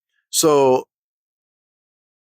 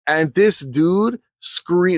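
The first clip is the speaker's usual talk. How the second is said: ordinary talk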